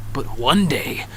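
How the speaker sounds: soft, low storyteller's setup; hushed, ominous lead-in; hushed suspense. Acted. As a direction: drop low and soft to tease the turn. Suspense, first take.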